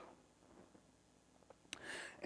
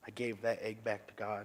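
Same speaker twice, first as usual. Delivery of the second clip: ordinary talk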